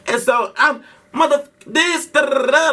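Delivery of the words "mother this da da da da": mock cussing rant. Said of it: "I'm going off".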